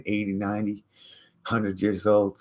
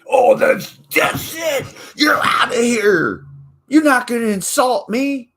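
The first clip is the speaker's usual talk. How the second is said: high, loud outraged squeal; shrill offended shout; 8 dB louder than usual. Retold or imitated. Offended person kicking him out, high outraged shout. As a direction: shriek like a wounded snob. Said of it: "offended person quoted".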